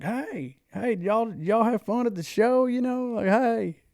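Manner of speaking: put-on high, loud drunken hollering across the street; tipsy greeting shouted across a street; drunk calling out. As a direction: call out to them like a happy drunk.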